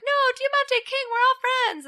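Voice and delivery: very high, whiny-sweet imitation; mock placating plea. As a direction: plead for peace way up high. Retold guys placating the two.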